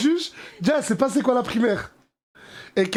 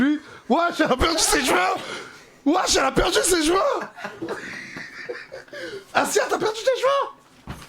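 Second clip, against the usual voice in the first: excited shouting; 9 semitones higher than usual. Real, not acted. Laughing at friend's lost hair.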